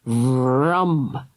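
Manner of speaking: usual speaking voice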